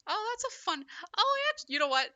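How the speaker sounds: high, loud delighted yelp; surprised. Real, not acted. Surprised yelp at the game's transition. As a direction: caught off guard by a twist.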